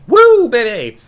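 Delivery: high, loud whoop; mock showboating. Wrestler showing off an arm drag, mock bragging. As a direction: whoop it like a cocky show-off.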